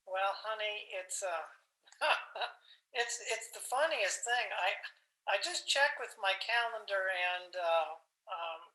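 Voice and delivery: halting, soft, nervous half-laugh; sheepish stalling. Acted. Husband on phone to wife about dinner date, sheepish stalling. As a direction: hem and haw, putting off bad news.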